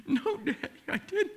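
put-on very high, small voice of a crying little girl; tearful child voice. Little daughter denying to her dad, high and tearful. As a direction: squeak it out like a four-year-old caught lying. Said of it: "she's just crying".

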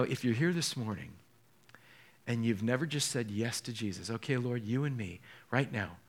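low, soft pastoral appeal; gentle altar call. Real, not acted. Preacher invites the church to say yes to Jesus, gentle appeal. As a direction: invite them softly and earnestly, pitched low.

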